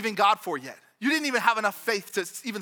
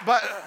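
real laugh; 5 dB louder than usual. Real, not acted. Laughs at own spelling.

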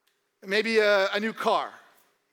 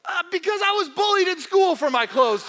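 mock hurt protest; 8 semitones higher, 5 dB louder than usual. Voicing bullied believer answering Jesus.

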